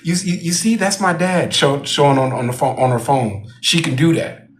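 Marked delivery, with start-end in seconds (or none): 0.0-1.5 high-pitched mocking girl's taunt. Young manager showing her dad on her phone, high taunting. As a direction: tease like a bratty kid, pitched high. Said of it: "the whole taunting".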